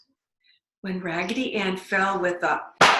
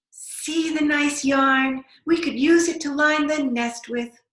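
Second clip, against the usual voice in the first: mother robin voice; 7 semitones higher, 7 dB louder than usual. Acted. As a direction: sing it out as an eager mother bird.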